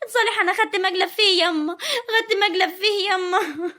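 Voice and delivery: sobbing to mother about a bad bargain; tearful wail of regret; crying complaint. Wife wails to mother about husband.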